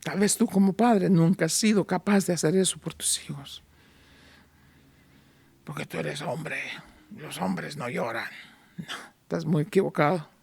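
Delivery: quiet heartfelt appeal; soft tender preaching. Acted. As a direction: draw them close gently. Soft intimate sermon passage.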